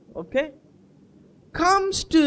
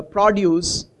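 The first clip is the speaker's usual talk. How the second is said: ordinary delivery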